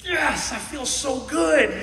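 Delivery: loud, slow, high cry of joy; joyful shout. Acted. Preacher acting out joy to the church, loud and elated.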